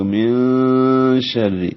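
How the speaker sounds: من شر drawn out slowly, noon nasalized and hidden. Shown as a demonstration. Teacher demonstrates ikhfa on من شر, slow. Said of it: "نون ساکن پہ آواز کو چھپائیں گے".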